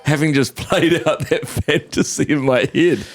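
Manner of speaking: giggly, mortified confession; amused cringing. Real, not acted. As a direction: laugh at your own embarrassment, drawing words out. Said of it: "I'm feeling awful".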